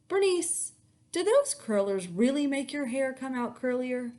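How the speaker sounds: high, loud, sweetly innocent; sugary charm. Acted. Girl asks with fake charm.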